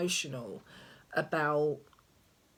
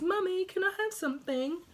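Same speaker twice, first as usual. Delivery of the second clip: put-on high, fast whiny child voice, far higher than her own; imitating her son; 14 semitones higher, faster than usual. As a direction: mimic a small boy nagging mum for a snack. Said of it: "That's all I hear all day".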